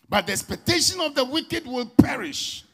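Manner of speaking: high, loud chanted preaching over music; preaching at full force. Acted. Pastor to congregation, loud chanted preaching. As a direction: lift the congregation with a sung cry.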